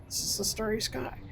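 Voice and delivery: starry lifted into a high, soft question; high questioning rise. Shown as a demonstration. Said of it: "repeats the line, rising".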